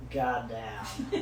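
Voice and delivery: low, softened retold aside; quiet, low-pitched mock reaction; muted disbelief. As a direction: say it under your voice, appalled. Watching boss harass cashiers.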